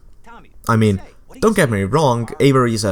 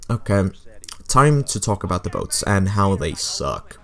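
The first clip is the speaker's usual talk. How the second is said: normal talking voice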